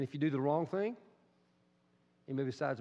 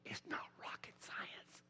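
whispered aside; 15 dB quieter, slower than usual. Real, not acted. Aside to congregation.

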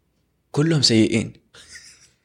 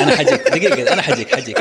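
laughing through the words, louder and higher; 4 semitones higher, 3 dB louder than usual. Real, not acted. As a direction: laugh it off while promising to come back to it. Laughing at friend's teasing remark. (يتكلم وهو يضحك)

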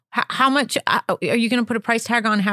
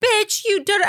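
high-pitched mock confrontation; high, put-on bitchy comeback; catty retort; 10 semitones higher than usual. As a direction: imitate a catty woman lashing out. Acts out the women's hostile reply.

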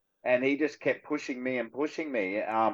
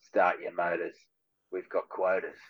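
low-pitched movie bad guy delivery; soft, deep-set character voice; film villain line; 5 semitones lower, 4 dB quieter than usual. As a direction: drop low for a villain's catchphrase. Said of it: "another one where I go".

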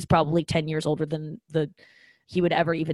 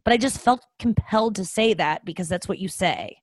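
usual speaking voice

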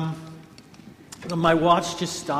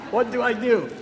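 panicked plea; 7 semitones higher, 6 dB louder than usual. Retold or imitated. Pianist panicking mid-performance.

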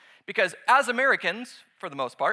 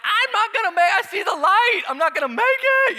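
much higher and louder, overacted dying gasps; hammed-up dying man; 13 semitones higher, 9 dB louder than usual. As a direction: ham up someone bleeding out. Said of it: "I'm like".

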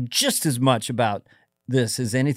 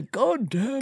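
a high, lightly mocking yell; mock dad yell; 9 semitones higher, 5 dB quieter than usual. Host echoing the guest's father, mock yelling. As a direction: imitate the cursing dad, half-shouted. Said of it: "Oh, really?".

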